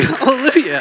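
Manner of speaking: high, loud hallelujah; reliving the good news, a high loud hallelujah; joyful hallelujah. Retold or imitated. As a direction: cry it out high in joy. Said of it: "And I'm like".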